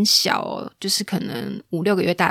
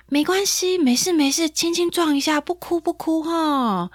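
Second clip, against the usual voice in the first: cooing parent; 9 semitones higher than usual. Retold or imitated. Acting a parent comforting a child.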